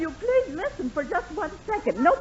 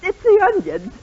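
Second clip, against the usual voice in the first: teary, louder and higher, fighting back tears; choked-up denial; on the verge of tears; 6 semitones higher, 7 dB louder than usual. Acted. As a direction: insist it's nothing while tearing up. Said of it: "You sound like you're going to burst out into tears".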